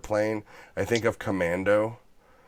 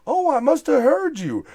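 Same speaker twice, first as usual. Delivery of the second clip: mimicking [name]; 4 dB louder than usual.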